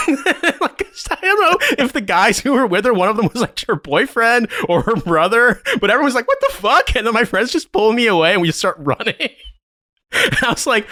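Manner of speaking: high-pitched, laughter-filled storytelling; laughing while telling. Real, not acted. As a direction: struggle to tell it through real laughter.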